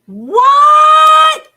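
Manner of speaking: screamed high what; real disbelieving scream; stunned, shouting what at the screen; shocked shout. Real, not acted. Shocked reaction to breaking news.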